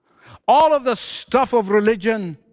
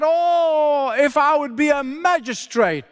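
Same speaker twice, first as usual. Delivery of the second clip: imitated crying out; 6 semitones higher than usual. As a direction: cry out the wish, pitched well up. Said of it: "Then he cried out".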